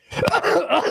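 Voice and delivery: coughing into a laugh; real cough then a laugh; chesty cough. Real, not acted. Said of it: "I'm coughing".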